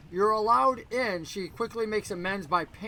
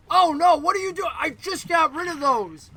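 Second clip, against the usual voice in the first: high, loud outburst of dismay; exasperated barkeep, pitched up and loud; 9 semitones higher, 9 dB louder than usual. Acted. Barkeep dismayed at seeing her return.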